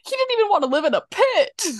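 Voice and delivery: high, fast indignant outburst; mock offended. Arthur complaining to Sue Ellen, mock offended outburst. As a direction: protest it high and quick, hammed-up. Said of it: "he's so offended".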